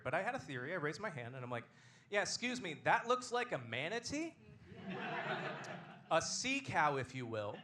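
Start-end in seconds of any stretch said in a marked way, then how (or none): 2.1-7.6 higher, drawn-out questioning pupil voice; pointed pupil query. Acted. Pupil offers science teacher a theory, high, slow questioning. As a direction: raise an acted classroom objection, pitched up.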